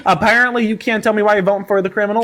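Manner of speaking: drawling mock country accent, lightly done; country accent slipping in and out; put-on country accent. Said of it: "whatever accent that was".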